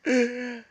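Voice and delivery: high sobbing breath; a real sob between words. Real, not acted. Crying at brother's memory.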